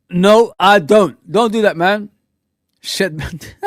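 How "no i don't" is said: slow and loud refusal; drawn-out no. Real, not acted. Refusing a fan's suggestion, slow and loud. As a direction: stretch out the denial. Rejecting a chat remark.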